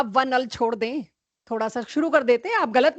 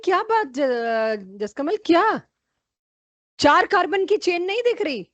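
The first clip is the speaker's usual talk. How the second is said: exasperated; 5 semitones higher than usual. Real, not acted. Teacher exasperated at wrong answer.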